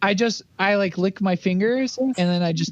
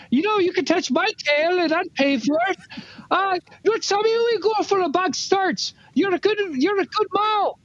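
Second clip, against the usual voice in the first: character voice; 9 semitones higher than usual. Acted. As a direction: stretch it out, pitched up high. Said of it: "I'm gonna be like".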